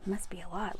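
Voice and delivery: ordinary delivery, nothing marked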